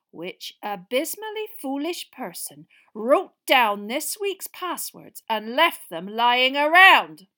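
slow, loud, trembling fury; barely contained anger; shaking with rage. Acted. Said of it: "her voice shaking".

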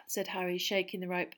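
ordinary delivery

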